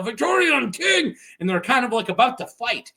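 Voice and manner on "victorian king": high mock rallying yell; crowd yell. Victorian supporters yell for their king, high chanting shout. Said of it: "supporters start to yell".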